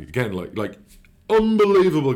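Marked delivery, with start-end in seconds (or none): 1.3-2.1 a sudden high, loud unbelievable; amazed outburst. Real, not acted. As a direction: burst out in disbelief. Recalling manager's confidence.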